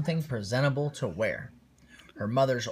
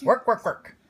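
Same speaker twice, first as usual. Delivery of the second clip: high, loud, fed-up complaint; disgusted; 10 semitones higher, 8 dB louder than usual. Acted. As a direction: spit out the words in disgust. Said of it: "he explained with disgust".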